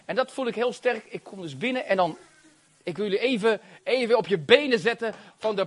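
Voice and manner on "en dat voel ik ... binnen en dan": ordinary delivery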